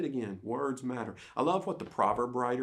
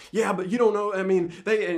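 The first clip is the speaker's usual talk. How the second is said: loud, higher retort; protesting; 8 semitones higher, 7 dB louder than usual. Retold or imitated. Pastor retelling his protest to his assistant, loud and high. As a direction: defend yourself heatedly. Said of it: "and I'm going".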